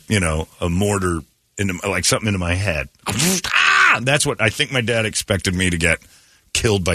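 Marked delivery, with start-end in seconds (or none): 3.1-4.0 mock yelp. Sudden cry mid story.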